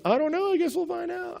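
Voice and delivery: high, coy shrug; mock evasive. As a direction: play it cagey and sing-song. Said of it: "trying to be tricky".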